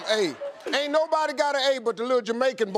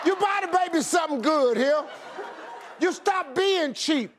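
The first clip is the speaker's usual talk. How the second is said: raised, scolding voice; 6 semitones higher than usual. Real, not acted. Scolding a cheap audience member.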